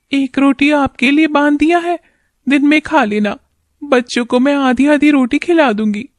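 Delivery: wife's voice. Acted. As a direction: speak as a caring wife fretting over her husband.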